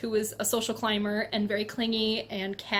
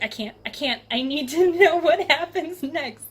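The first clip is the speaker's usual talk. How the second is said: rising into a louder, higher, laughing need to know; hooked and excited; 5 semitones higher, 7 dB louder than usual. Retold or imitated. Reader hooked on the series, building to an excited higher outburst. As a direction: build up, desperate for the next book. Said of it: "I'm just like".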